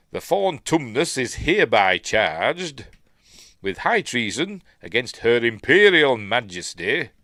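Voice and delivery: booming town-crier reading; proclamation. Acted. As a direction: proclaim it like a royal decree. Reading out an official warrant.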